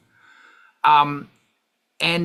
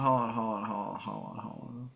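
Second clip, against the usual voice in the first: fading mutter; 5 semitones lower, 10 dB quieter than usual. Real, not acted. Streamer busy with cards, fading murmur. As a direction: hold on drifting down to a murmur, much softer. Mumbling while distracted.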